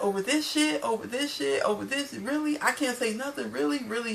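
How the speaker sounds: high-pitched mock indignation; retold upset disbelief. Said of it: "You could tell Rocky was completely upset".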